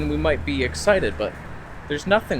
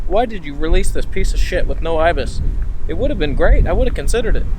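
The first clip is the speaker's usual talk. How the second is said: mock rant; 4 semitones higher, 7 dB louder than usual. Real, not acted. Ranting at Nikon about missing IBIS.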